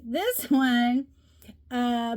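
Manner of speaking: ordinary talk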